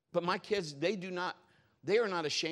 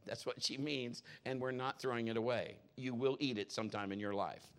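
ordinary delivery

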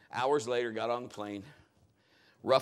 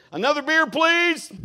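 higher, louder, a hint of a tipsy passenger; lightly voiced drunk ordering more beer; drunk man asking; 11 semitones higher, 10 dB louder than usual. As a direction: drunk passenger turning to the crew.

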